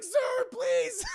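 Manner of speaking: very high, desperate yelp; a terrified victim squealing; pleading shout. Retold or imitated. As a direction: beg him to stop. Victim begs Batman.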